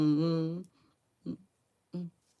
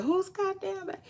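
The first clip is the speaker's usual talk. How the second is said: high mock exclaim of what people say; mocking quote; 13 semitones higher, 4 dB quieter than usual. Imitating gossiping people, high and mocking. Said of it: "how people be saying".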